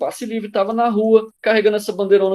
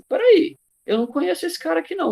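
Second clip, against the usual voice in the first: sudden high-pitched puzzled objection; mock bewilderment; 11 semitones higher than usual. Original protester spotting strangers in the march, mock bewilderment. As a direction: jump up high as a baffled protester.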